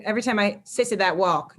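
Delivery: usual speaking voice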